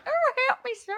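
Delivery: high breathy damsel plea; damsel in distress. Retold or imitated. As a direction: play a swooning helpless lady.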